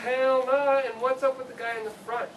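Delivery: scoffing. Acted. Protagonist scoffs at friend.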